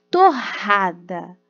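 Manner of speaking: "Torrada" slow and loud with a strong guttural R. Shown as a demonstration. Teacher demonstrates the strong R in "Torrada" slowly and loudly. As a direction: stretch the RR in "Torrada" hard. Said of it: "o som forte".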